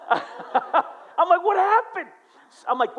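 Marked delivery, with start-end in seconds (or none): 0.0-1.4 laughing through the lead-in. Real, not acted. Laughs while retelling. 1.4-2.1 high, loud retold astonishment; astonished. Astonished question in retold story.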